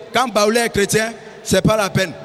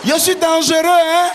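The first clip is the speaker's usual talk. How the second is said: tough guy boast; 5 semitones higher, 3 dB louder than usual. Retold or imitated. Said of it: "il dit, Tss".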